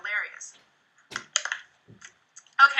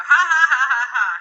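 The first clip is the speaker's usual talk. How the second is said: loud, deliberate fake ha ha; fake laugh; 2 semitones higher, 9 dB louder than usual. Shown as a demonstration. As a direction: spell out a forced laugh, syllable by syllable. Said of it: "give me a fake laugh".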